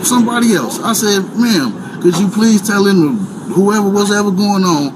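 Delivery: ordinary talk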